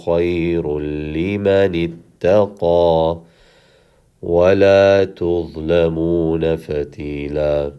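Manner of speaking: measured, chanted tajweed recitation; Quran recitation. Acted. Teacher recites the verse for the class in chant.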